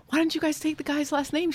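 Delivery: quoted self. Retold or imitated. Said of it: "I'm like".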